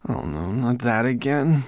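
high-pitched muttered dismay. A narrator's reading. Weary servant hearing the old request, muttering high. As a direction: grumble to yourself in a small voice. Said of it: "muttered Twigleg".

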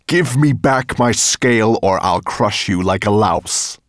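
loud menacing dragon demand; threatening dragon. Acted. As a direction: crush him with the threat.

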